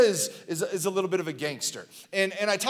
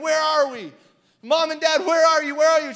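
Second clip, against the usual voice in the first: loud, high frightened cries for mom and dad; retold child's scream; 9 semitones higher, 8 dB louder than usual. Toddler calling for her missing parents in a panic. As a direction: yell like a scared little kid. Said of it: "she starts screaming".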